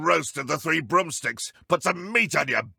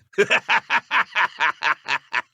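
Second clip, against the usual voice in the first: gloating laugh; 5 semitones higher, 4 dB louder than usual. Acted. Enemy gloats after taking hits.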